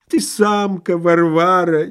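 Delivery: high, drawn-out moaning insult; self-pitying husband's wail; drawling whine. A narrator's reading. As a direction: whine it out, stretching the vowels. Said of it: "тягуче заныл он".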